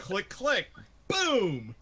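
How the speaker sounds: loud spoken click and boom; gun sound effects. Acted. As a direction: act out a rifle loading and firing, high and loud. Imitates gun loading and firing.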